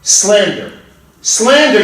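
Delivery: rising outraged cry of slander; indignant denial. Acted. Accused barber to the judge, outraged protest. Accused man denies charge.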